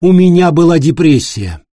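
loud, high firm admission. A narrator's reading. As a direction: state it firmly. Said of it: "твёрдым голосом".

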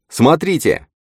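high, urgent cry; sudden high-pitched exclamation; exclaiming. A narrator's reading. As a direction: call out to get their attention. Jace exclaims, pointing across river.

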